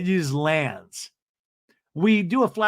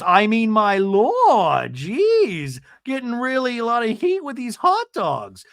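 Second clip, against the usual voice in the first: higher, slow, put-upon whine; imitating exasperated Gina; 7 semitones higher, slower than usual. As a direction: drag it out, martyred.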